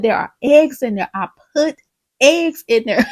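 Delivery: loud, emphatic contradiction; stubborn insistence. Retold or imitated. Husband swears he bought the eggs, insistent. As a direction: insist forcefully on being right.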